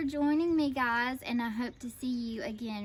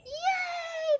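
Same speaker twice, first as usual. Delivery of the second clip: high, drawn-out hurrah; cheering. A narrator's reading. Crowd sees captive freed, cheering. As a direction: cheer for the escape. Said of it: "the crowd cheered".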